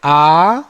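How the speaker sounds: आ held longer, two beats; long vowel aa. Shown as a demonstration. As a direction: stretch आ to double length. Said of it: "इसको बोलने में हमें ह्रस्व का दो गुना".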